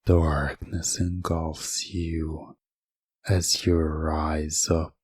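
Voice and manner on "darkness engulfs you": ordinary talk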